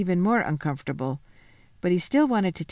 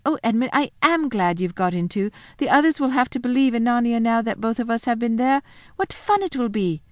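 put-on high, bright little girl voice; Lucy, happy and excited; 5 semitones higher, 3 dB louder than usual. Lucy telling Edmund about her lunch, high and excited. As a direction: chatter eagerly like a glad child.

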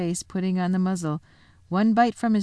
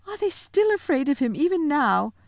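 put-on much higher, sobbing girl's voice; sobbing Susan; 9 semitones higher than usual. A narrator's reading. Susan sobbing as the lion is tied. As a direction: cry it out in grief. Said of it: "sobbed Susan".

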